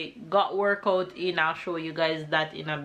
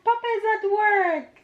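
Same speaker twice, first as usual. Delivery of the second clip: high, sing-song mommy voice; 14 semitones higher, 4 dB louder than usual. Real, not acted. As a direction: pitch it up for a little one. Answering toddler again.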